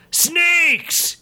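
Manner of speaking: high yelled call; a high yelled summons; yelled command. Acted. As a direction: summon them with a sharp high yell. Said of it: "yelled Foewind".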